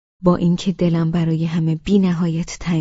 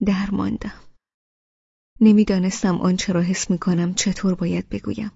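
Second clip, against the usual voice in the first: plain reading voice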